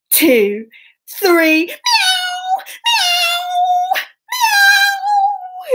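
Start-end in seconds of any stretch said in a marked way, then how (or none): 1.6-5.7 very high, long falling meows; cat meows. Pretend. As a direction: wail like a cat on the run. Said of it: "lots of meows".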